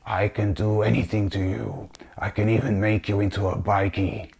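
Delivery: soft, crusty voice of a possessing demon; horrible demon voice. Retold or imitated. Demon speaking through the lady to threaten him, horrible crusty voice. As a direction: imitate an awful demon threatening under its breath. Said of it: "this horrible, uh, awful voice starts talking again".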